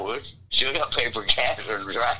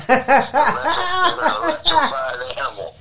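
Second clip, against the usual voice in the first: high, slow words through laughter; laughing; 8 dB louder, much slower than usual. Real, not acted. Teasing friend, laughing.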